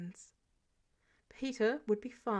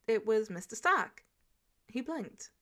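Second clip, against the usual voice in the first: usual speaking voice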